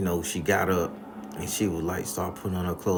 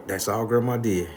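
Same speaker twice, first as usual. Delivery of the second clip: normal talking voice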